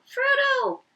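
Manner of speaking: high, loud call, panting. Acted. Sam toiling uphill, panting and calling for Frodo. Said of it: "panting and calling".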